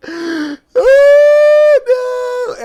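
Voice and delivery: high wailing sob; crying. Retold or imitated. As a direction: wail it out like a sob. Dismayed reaction after rejection.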